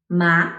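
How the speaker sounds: rising "ma"; "ma" climbing upward; second tone. Shown as a demonstration. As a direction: lift "ma" like a question. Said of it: "recap these four tones".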